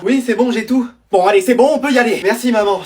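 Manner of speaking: ordinary talk, nothing marked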